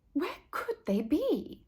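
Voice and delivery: breathy worried whisper to herself. A narrator's reading. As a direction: drop to a whisper, fretting. Worried mother wonders aloud.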